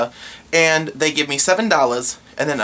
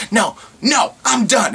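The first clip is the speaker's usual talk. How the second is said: high, shouted I'm done; imitating her slamming it down; hammed-up furious customer; mock angry shout; 7 semitones higher, slower than usual.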